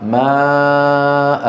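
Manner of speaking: ماء with the final alif drawn out long; long madd on the final alif. Shown as a demonstration. Teacher showing the pausal form, slow elongated madd. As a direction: stretch ماء slowly, holding the long vowel. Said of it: "كيف نقف عليها؟".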